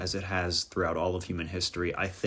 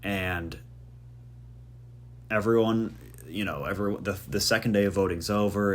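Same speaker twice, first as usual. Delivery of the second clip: ordinary talk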